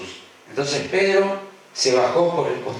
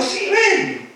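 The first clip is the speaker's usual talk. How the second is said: much higher, bright-eyed yes; happy; 4 dB louder than usual. Acted. Jesus gladly invites Pedro onto the water, joyfully high. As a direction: answer delighted, as a glad Jesus. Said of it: "Jesús contento dijo".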